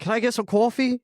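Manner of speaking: high, put-on British accent; mock British accent. Friends joking about waking up British: high mock British accent.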